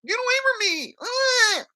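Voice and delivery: very high panicked squeal, imitating; mock scared. Guy running from a fight challenge, mock panicked squeal. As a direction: squeal like a coward backing away. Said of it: "was all scared and shit".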